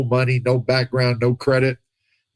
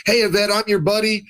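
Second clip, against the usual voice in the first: hammed-up salesman, higher pitch; an overeager friend pitching a deal, voice pitched higher; pushy money pitch; 9 semitones higher than usual. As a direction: sell it like an overeager buddy with a deal.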